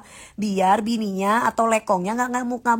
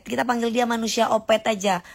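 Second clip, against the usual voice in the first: ordinary talk, nothing marked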